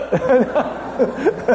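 a light, high burst of laughter; real laugh. Real, not acted. Speaker laughing about gambling on the lucky draw, amused laugh.

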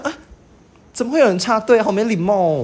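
high, put-on girly whine; girly complaint. Mock girly complaint at a queue jumper. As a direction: play the offended girl in the queue. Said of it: "我就用，用这个语气这样".

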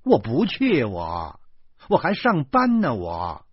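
loud, high angry protest; stern shout. A narrator's reading. Exasperated wife refusing the school run, sternly. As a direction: refuse sharply, punching high then dropping. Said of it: "她厉声地说".

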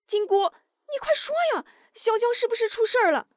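put-on a young lad jumping up in alarm; high young voice pleading urgently; anxious youngster. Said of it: "世奇忽然站起来".

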